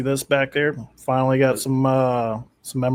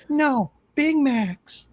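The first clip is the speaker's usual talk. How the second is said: slow, drawn-out, much higher comeback; dopey comeback; 13 semitones higher, much slower than usual. Retold or imitated. Kid answers the teasing basketball guy, drawn out and high. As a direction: draw it out like a kid caught off guard. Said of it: "I'm like".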